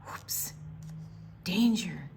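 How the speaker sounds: plain reading voice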